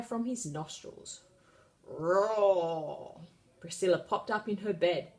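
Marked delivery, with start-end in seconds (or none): 1.9-3.2 dragon roar. A narrator's reading. Dragon roars.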